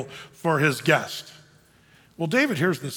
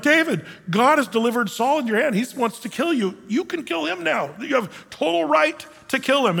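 higher-pitched, eager urging; 6 semitones higher than usual. Acted. David's men urging him to kill Saul, eager.

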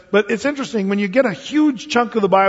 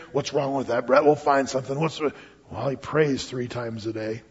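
low, soft conspiratorial muttering; lightly voiced sneaky accusers; scheming plotters; 5 semitones lower, 5 dB quieter than usual. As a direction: plot it quietly like schemers sharing a secret. Plotters scheming against Daniel.

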